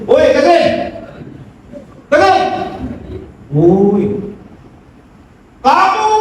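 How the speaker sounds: grito alto y agudo de un loco; mock shout. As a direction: llamarlo a gritos desde la puerta. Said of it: "dia menjerit".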